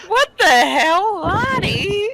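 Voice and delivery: high, stunned yelp at the friend; shocked outcry. Acted. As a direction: squeak it out in disbelief. Shocked Max yells at Lottie.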